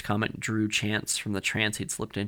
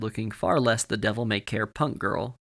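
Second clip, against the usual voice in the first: ordinary delivery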